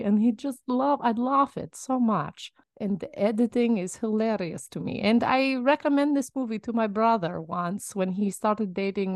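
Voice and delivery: hammed-up Russian accent with stretched words; fake Russian accent. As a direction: answer at leisure in a put-on Russian accent. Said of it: "do your reaction in your Russian accent".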